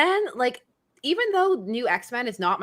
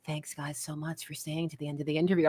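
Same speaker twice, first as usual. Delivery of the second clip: softer late night radio sign-off; late night announcer voice; 6 semitones lower, 11 dB quieter than usual. Retold or imitated. Host imitating her own softer late night announcer outro. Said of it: "my late night announcer voice".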